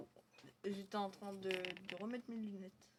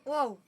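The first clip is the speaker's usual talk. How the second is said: loud sudden wow; startled yelp; 4 semitones higher, 18 dB louder than usual. Real, not acted. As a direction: jump and cry out. Startled in game.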